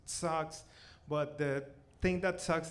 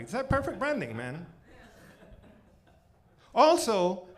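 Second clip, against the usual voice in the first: usual speaking voice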